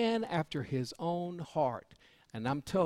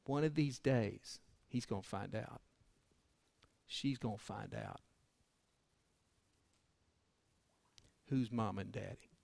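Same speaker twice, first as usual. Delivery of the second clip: hushed and grave; 4 semitones lower, 7 dB quieter than usual. Real, not acted. Grave point about abandoned children.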